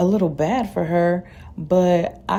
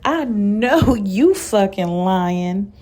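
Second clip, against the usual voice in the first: loud outburst; 3 semitones higher, 4 dB louder, much slower than usual. A narrator's reading. Portia cutting in from behind, loud disbelieving outburst. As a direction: burst out loudly, stretching the words. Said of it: "let out a loud".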